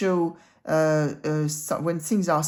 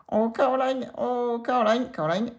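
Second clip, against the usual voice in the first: higher cheeky goat voice crooning a name; a goat sweet-talking her artist; Lucky calling Caroline; 5 semitones higher than usual. Acted. Goat Lucky calls out to Caroline, high playful goat voice. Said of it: "So what voice would she have?".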